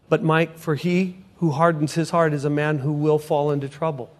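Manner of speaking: soft, low and gentle scripture; God speaking scripture. Retold or imitated. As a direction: speak it quietly as God would. God quoting scripture to Mike.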